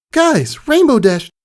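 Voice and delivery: high, loud, hurried; high urgent call; urgent excited. Acted. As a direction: burst out to friends. Scootaloo whispers urgently.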